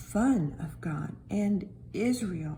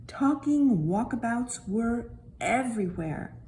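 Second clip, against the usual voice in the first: usual speaking voice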